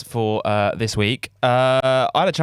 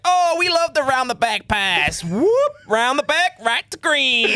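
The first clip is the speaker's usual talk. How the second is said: higher, hyped basketball commentator call; Rob Paternoster commentary; 10 semitones higher, slower than usual. Retold or imitated. Mimicking the commentator's call of an assist, excited and higher. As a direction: ham up an excited play-by-play call, whoop and all. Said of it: "Watch Rob Paternoster on commentary".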